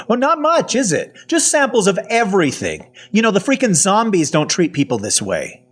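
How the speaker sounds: high-pitched sarcastic rant; agitated. A narrator's reading. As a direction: let the sarcasm boil over, pitch way up. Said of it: "I am very agitated".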